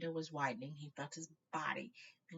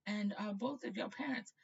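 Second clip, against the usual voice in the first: put-on old headmaster; 6 semitones higher, 5 dB louder than usual.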